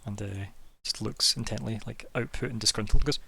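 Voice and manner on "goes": ordinary talk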